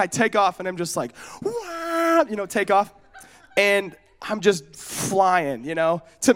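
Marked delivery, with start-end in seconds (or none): 1.4-2.2 high-pitched "wah" cry; comic yelp of alarm; mock startled wail. Boy shooting off on a too-fast bike, mock wail.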